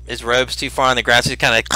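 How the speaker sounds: ordinary talk, nothing marked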